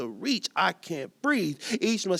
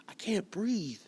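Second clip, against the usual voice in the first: ordinary talk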